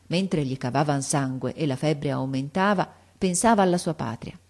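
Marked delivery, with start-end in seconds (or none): none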